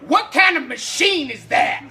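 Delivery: slow loud granny question; old lady voice. Retold or imitated. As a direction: croak it out like a nosy old woman. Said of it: "she says".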